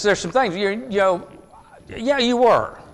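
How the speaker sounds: a hint of a nosy stranger pressing Peter; accusing bystander. A stranger in the courtyard presses Peter, lightly voiced and insisting.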